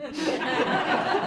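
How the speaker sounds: high mock cackle; a gleeful cackle; high gleeful laugh. Yellow deity laughing at the thief, high cackle. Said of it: "she was laughing".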